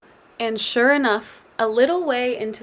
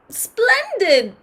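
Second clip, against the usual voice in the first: excited cry; 12 semitones higher than usual. A narrator's reading. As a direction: squeal it with glee. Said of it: "cried the witch".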